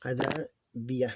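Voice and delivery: Adabia said slowly, syllable by syllable; slow teaching read of Adabia; slow syllable demo. Shown as a demonstration. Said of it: "Adabia, like this".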